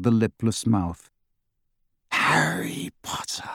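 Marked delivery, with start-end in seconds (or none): 2.1-3.5 high, slow villain whisper; hushed, drawn-out menace; very soft. A narrator's reading. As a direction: breathe it out slowly, like a spitting fire. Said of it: "he said very softly".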